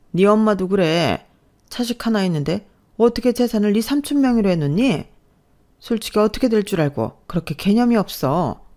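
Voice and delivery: criticize her family with open disdain; scornful mother-in-law. Acted. (한심하다는 듯 따지는 시어머니)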